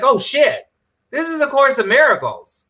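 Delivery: high-pitched re-enacted revelation; retold astonishment. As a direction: act out the moment it hit you, pitch jumping up. Retells own moment of sudden realisation.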